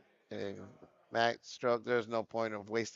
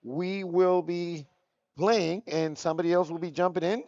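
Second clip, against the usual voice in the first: higher, louder hyped play-by-play voice; loud announcer call; 7 semitones higher, 9 dB louder than usual. Acted. Caster announcing the advancing team to viewers, hyped and loud. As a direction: belt it out like a tournament caster.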